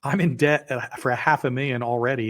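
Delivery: anxious pleading; worried. Retold or imitated. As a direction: panicky voice. Retold argument with partner.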